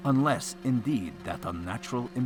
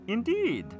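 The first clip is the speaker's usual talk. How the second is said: high theatrical echo; stagey vassal repeating; hammy stage actor; 4 dB louder than usual. A narrator's reading. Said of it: "repeated the vassal".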